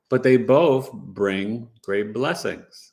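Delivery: ordinary delivery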